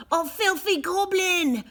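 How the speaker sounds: high, loud disgusted shriek; shrieking farmer. A narrator's reading. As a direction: shriek it at the goblin. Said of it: "shrieked the farmer".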